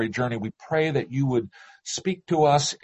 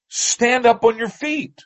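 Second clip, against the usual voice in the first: raised, higher-pitched command; narrated shout; 11 semitones higher, 5 dB louder than usual. A narrator's reading. Paul to the man born crippled, narrated shout. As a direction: call it out high, mildly shouted. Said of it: "So Paul shouted".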